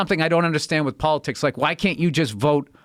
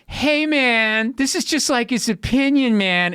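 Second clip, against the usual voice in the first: mock laid-back conservative; 7 semitones higher, slower than usual. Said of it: "everybody on the right's like".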